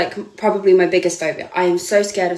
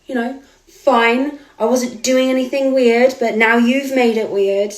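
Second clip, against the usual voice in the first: mocking the photographer; 4 semitones higher than usual. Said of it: "And he kind of goes".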